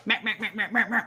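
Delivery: quick, repeated piglet noises; mad piglet squeal. Retold or imitated. Owner imitates her angry piglet going off, mad squealing. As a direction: imitate a grumpy little pig's squealing. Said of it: "And he goes".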